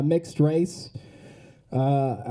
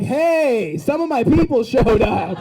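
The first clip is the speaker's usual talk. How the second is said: high, louder, drawn-out cheer; hammed-up happy shout, pitched up; delighted greeting; 5 semitones higher, 5 dB louder, slower than usual. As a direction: call it out slowly, thrilled to see your people. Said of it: "I couldn't even be mad".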